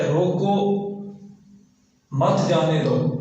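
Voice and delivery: روکو alone, long pause, then مت جانے دو; pause after روکو. Shown as a demonstration. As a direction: stop firmly after روکو, then say مت جانے دو as one phrase. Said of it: "ایک وقف کرنے سے مطلب اور بنا ہے".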